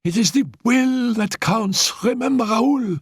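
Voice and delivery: put-on high, determined voice of a frail girl; stubborn and high, willing herself to live; defiant invalid. Annette vowing to Raoul, high defiant voice.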